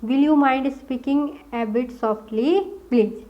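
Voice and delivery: slow, word by word; slow dictation. Shown as a demonstration. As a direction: dictate it slowly. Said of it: "उसको कैसे बोलोगे?".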